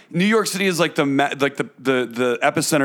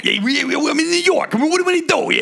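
mock New Yorker; 11 semitones higher than usual. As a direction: play a cocky New Yorker showing off.

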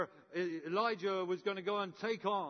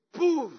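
a loud, high burst of a fireball; explosive poof; 9 semitones higher, 13 dB louder than usual. Retold or imitated. The preacher re-creating his exploding barbecue with a loud poof. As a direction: blast it out like flames going up.

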